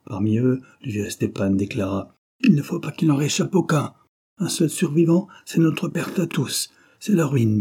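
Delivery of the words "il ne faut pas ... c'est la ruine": put-on old man pushed higher and louder, urgent; a lightly voiced old leader, pressing; stern old man. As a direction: insist like a grim elder. Old Stepan urges the ambush.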